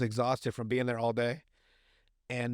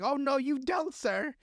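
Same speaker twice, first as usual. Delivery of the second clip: mock scream; 11 semitones higher, slower than usual. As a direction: imitate a manager crying out in protest. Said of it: "my manager start screaming".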